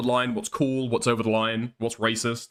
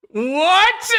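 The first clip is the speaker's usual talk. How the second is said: high, loud incredulous yelp; shocked what; 8 dB louder than usual. Real, not acted. Streamer yelping in disbelief at the clip, high and loud. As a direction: blurt it out in sheer disbelief.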